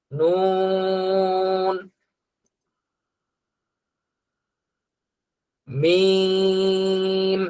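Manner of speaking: নুন and মিম each held long and slow; drawn-out letters. Shown as a demonstration. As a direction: model each letter slowly for repeating. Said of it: "আহ, লাগবে". (ধীরে টেনে হরফ উচ্চারণ)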